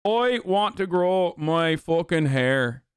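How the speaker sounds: slow, broad put-on Irish accent. Streamer answering haircut jibe in Irish accent. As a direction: draw it out in exaggerated Irish. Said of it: "do an Irish accent".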